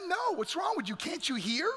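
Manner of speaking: put-on high, sharp scolding of a kid brother; acted exasperated rejection; irritated rebuff. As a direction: snap impatiently at the pest.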